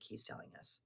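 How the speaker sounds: ordinary talk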